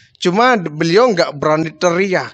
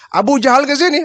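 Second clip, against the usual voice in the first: higher, urgent question; anxious question; 6 semitones higher than usual. Acted. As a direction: ask with rising worry. Prophet asks anxiously. (pertanyaan cemas, lebih tinggi)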